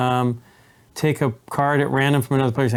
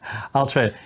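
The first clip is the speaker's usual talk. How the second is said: normal talking voice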